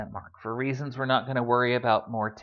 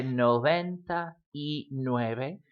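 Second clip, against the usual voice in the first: slow noventa y nueve with y standing apart; separate-word pattern; slower than usual. Shown as a demonstration. Teacher answering how to say ninety-nine, slow and separated. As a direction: say noventa, y, nueve slowly as three words. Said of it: "all of them follow that first pattern".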